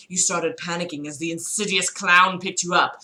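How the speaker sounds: usual speaking voice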